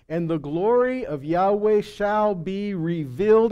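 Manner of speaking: ordinary talk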